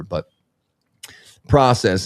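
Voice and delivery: normal talking voice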